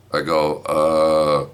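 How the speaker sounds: ordinary talk, nothing marked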